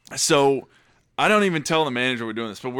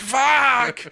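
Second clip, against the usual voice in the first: mock raging yell; 10 semitones higher, 3 dB louder than usual. Imitating the bleeding manager's outburst.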